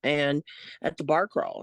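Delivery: usual speaking voice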